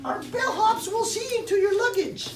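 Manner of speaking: high polite clerk voice; hotel clerk. Acted. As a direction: greet guests like a chirpy receptionist. Hotel staff greeting guests.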